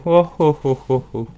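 loud cries tapering off; train-whistle cries. A narrator's reading. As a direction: wail like a locomotive whistle. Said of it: "Ses cris ressemblaient si exactement au sifflet".

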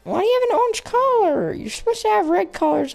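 very high mock whine; high-pitched complaint. Player questioning pet's orange collar, high mock whine.

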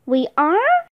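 rising, surprised question; mild disbelief, lifting at the end. A narrator's reading. Girl questioning her brother's answer, surprised. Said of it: "Annie asked with surprise".

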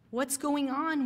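sharp, impatient question; demanding. A narrator's reading. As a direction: demand an answer from the leader. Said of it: "one youth demanded".

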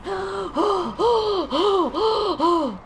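repeated audible gasping breaths; heavy recovery breathing. Real, not acted. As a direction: puff to calm yourself down. Told to breathe, catches her breath.

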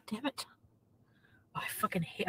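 annoyed whispered grumble; real whisper. Real, not acted. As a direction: whisper the complaint under your breath. Annoyed aside at mattress.

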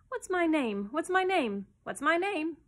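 high, loud, urgent questioning; anxious bird demanding an answer; shrill, anxious. A narrator's reading. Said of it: "in a shrill, anxious voice".

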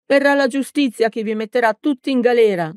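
high-pitched, indignant comeback; narrated girl's heated exclamation; exclaimed retort. A narrator's reading. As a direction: throw the threat back, pitched high. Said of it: "esclamò la ragazza".

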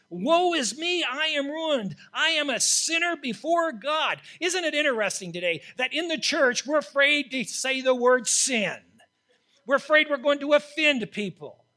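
loud, high, fervent preaching; preaching at full force. Acted. Preacher to the congregation about sin, preaching at full force. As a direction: thunder it from the pulpit. Preaches Isaiah's cry and beyond.

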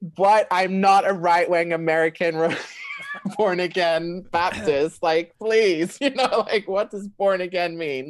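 high, loud, laughing mock panic; retold freaking out. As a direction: protest in comic alarm, pitch up. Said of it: "I'm freaking out".

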